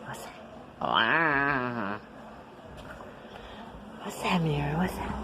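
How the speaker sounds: soft secret whisper. Real, not acted. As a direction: breathe it, no voice. Mother whispering to baby.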